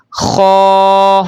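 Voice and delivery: خو held long with a scraped upper-throat kh; heavy, rounded, drawn-out خو; thick, heavy letter. Shown as a demonstration. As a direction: recite خو full-mouthed on a steady note. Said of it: "موٹی آواز کے ساتھ ہی پڑھنا".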